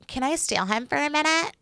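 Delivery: high, soft mimicked request; imitated interrupting contestant. Imitating Jess cutting in on a chat, high soft request. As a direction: cut in lightly, voice lifted and hushed.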